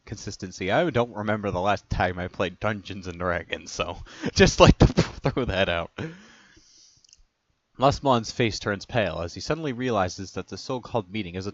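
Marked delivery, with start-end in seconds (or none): 4.2-5.9 laughing words. Real, not acted. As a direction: laugh through the line. Laughing while talking.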